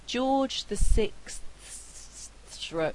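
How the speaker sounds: "George the sixth thro-" drawn out, every final consonant attempted; careful full cluster. Shown as a demonstration. Phonetics lecturer showing how hard the cluster is, careful and laboured. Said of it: "it's actually very, very difficult to say".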